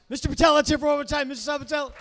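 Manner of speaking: loud, high club emcee call; announcer hype. Real, not acted. Host sending off the comic, loud announcer hype.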